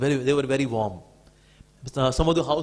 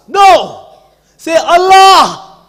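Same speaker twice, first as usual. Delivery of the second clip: loud, high, slow furious yell; angrier shout; 12 semitones higher, 21 dB louder, much slower than usual. Retold or imitated. Fanatic demanding the word again, furious shout. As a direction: drag out the word in rage. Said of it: "Now he was becoming angrier".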